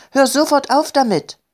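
high-pitched, urgent command; crying out. A narrator's reading. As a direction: call out in upset protest. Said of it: "rief Elli". (aufgebracht gerufen, mit hoher Stimme)

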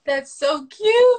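high, loud, drawn-out gush; stretched-out cooing squeal. Real, not acted. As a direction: squeal it slowly with delight. Gushing over a comment.

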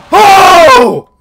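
high, very loud shriek of fright; startled scream. Real, not acted. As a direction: scream out at a jump scare. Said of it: "I'm screaming like a little baby".

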